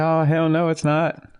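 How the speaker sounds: high mock angry dad voice; imitating his dad. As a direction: play the dad refusing in a high put-on voice. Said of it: "He's gonna".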